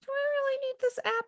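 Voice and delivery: very high, small squeaky voice; inner debate. Retold or imitated. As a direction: question yourself in a tiny high pitch. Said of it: "I was like".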